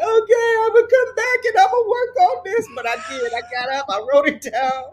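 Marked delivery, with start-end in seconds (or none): 0.0-2.7 high, loud rushed promise to herself; hurried promise. Retold or imitated. Mom rushing kids off to school, high loud promise. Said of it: "And I'm like".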